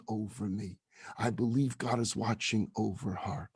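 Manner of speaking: hushed, low, deeply moved; tearful belief. Retold or imitated. Mother tells rabbi she believes God is watching, tearfully moved. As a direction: retell her tearful words softly, with heavy pauses. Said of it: "she's shaking and she's crying".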